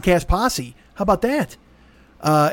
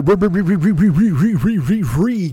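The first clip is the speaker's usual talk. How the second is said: stammering on re-; stuck repeating re-; stutter; 3 dB louder, slower than usual. Shown as a demonstration. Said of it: "stuttering on re".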